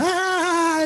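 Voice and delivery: mock high-pitched squeal; long, high-pitched "ah"; high held shriek. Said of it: "and she's like".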